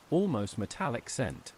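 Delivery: ordinary delivery, nothing marked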